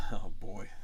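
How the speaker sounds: hushed whisper. Real, not acted. Reacts to the game text.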